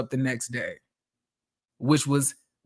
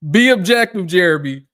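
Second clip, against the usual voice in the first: emphatic reminder; 7 dB louder than usual. Shown as a demonstration. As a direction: say it like a firm reminder to yourself. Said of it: "let me show y'all".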